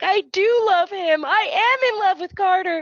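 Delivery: hammed-up weepy wife confessing; mock tearful confession. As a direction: stretch it out in a put-on high voice. Retold wife's dramatic confession.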